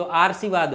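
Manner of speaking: आशीर्वाद said as "aar-shee-vaad"; mispronounced. Shown as a demonstration. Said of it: "नहीं, ये तो बात बनी नहीं". (आशीर्वाद को गलत "आर्शीवाद" बोलते हुए)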